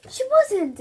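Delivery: embarrassed mum; flustered denial. Acted. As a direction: protest in embarrassment. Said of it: "turning pink".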